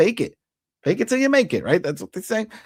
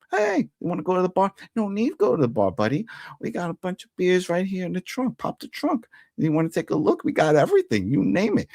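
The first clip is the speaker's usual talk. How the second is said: much higher, breezy sales-pitch tough guy; mock friendly mobster; 7 semitones higher than usual. Recapper voicing the mobster offering beers from the trunk, mock-friendly higher voice. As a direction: play the grinning wiseguy luring him in.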